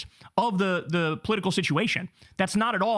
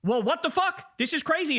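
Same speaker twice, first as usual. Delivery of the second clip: raised pitch, stunned disbelief; mock outrage; 8 semitones higher than usual. Host voicing the baffled public, stunned and high. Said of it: "Everybody's looking at it going".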